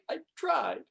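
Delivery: high but no longer shouted; subsiding anguish. Acted. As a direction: let the outburst fall away in defeat. Same protest, subsiding after outburst.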